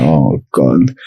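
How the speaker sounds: a low scared groan at the teacher's words; mock dread. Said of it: "I was like".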